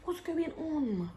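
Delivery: slow, high puzzled voice. Retold or imitated. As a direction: drag it out in confusion. Confused, slow, far voice.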